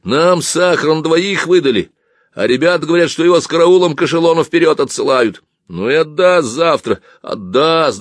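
high, loud, aggrieved grumbling; angry complaint. Acted. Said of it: "рассерженно ответил тот". (рассерженная жалоба)

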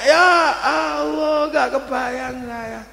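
slow, high, loud whimpering "ya Allah"; hammed-up near-sob; mock despair. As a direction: moan as if about to cry over the mess. Father overwhelmed by dirty diaper.

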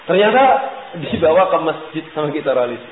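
ordinary talk, nothing marked

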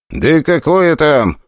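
slow tired reply; weary. A narrator's reading. Guard answering the leader, slow and tired. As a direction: drag it out wearily. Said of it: "устало ответил".

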